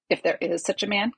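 ordinary delivery, nothing marked

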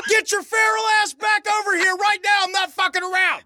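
high-pitched, exasperated yell; shouted order. Retold or imitated. Alex calling Skeeter back from the woods, shouted order. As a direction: holler an angry command into the distance.

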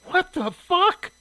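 very high, loud, drawn-out outburst; outraged exclamation. A narrator's reading. Shirley throwing down the newspaper, exclaiming in outrage. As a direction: explode in indignant disbelief.

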